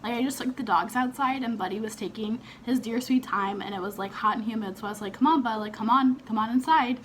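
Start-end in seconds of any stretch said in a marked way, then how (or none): 5.1-7.0 loud call, raised and pitched up; calling the dog. Retold or imitated. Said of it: "yell his name for him to hear".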